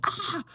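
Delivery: short high-pitched mock scream; imitated girl scream. Friend suddenly comes out of it, mock girlish scream. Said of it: "like a girl scream".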